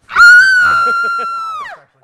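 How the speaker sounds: shrill, drawn-out haunted house scream; piercing horror scream. Pretend. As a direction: let out your best scare scream. Audition scream on request.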